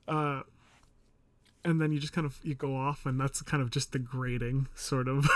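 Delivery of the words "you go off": usual speaking voice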